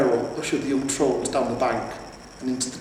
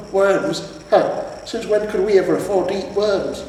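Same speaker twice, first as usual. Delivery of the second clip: high, loud scoff; scoffing troll wife; 6 semitones higher, 5 dB louder than usual. A narrator's reading. Troll wife scoffing at her husband, high. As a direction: laugh him down.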